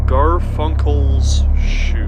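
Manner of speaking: dramatic storyteller reading over music; story narration. A narrator's reading. Narrator reading a shoe's story to viewers, dramatic. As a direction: narrate it like a grim tale.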